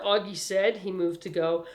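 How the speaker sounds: ordinary delivery, nothing marked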